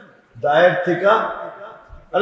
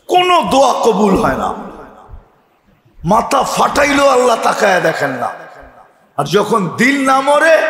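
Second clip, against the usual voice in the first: raised, fervent sermon voice; full-force preaching; 5 semitones higher than usual. Acted. Preacher urging the congregation about dead hearts, fervent. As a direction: preach it out to the crowd with fire.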